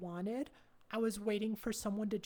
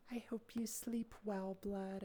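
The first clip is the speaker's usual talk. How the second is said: whispering; 5 dB quieter than usual. Real, not acted. Whispered goodnight.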